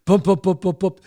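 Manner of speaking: loud, rhythmic thumping syllables; footsteps imitation. Storyteller mimics dad coming down basement steps, rhythmic thuds. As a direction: thump out each step coming down.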